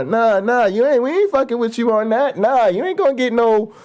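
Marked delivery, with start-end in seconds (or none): none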